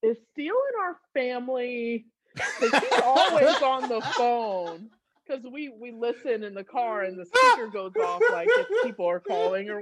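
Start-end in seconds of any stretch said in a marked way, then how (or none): 0.0-4.9 put-on child's question. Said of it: "and he's like".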